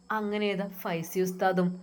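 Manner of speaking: plain reading voice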